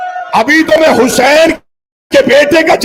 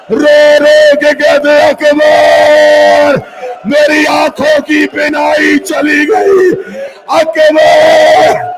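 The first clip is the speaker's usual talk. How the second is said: long-drawn sobbing cry of the name; a father's broken wailing call; anguished wail; much slower than usual. Acted. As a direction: stretch the name out in grief. Father crying out for son.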